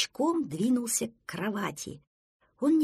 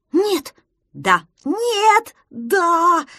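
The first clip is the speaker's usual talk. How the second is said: shouted bickering; 10 semitones higher, 9 dB louder, much slower than usual. Acted. Two friends argue over the lion, shouting. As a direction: trade yells like squabbling children.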